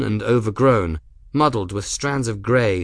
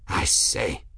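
barely contain your amusement; incredulous, bubbling undertone; suppressed mirth; 7 semitones higher than usual. A narrator's reading. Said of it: "Mirth bubbled underneath the words".

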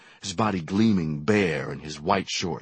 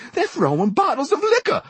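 very high shrieked alarm; high panicked scream; 5 dB louder than usual. A narrator's reading. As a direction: shriek it across the room. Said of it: "a woman screamed".

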